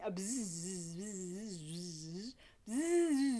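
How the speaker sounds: soft buzzing bee noise; quiet bee buzzing. Shown as a demonstration. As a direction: buzz like a little bee. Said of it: "perhaps a little bee".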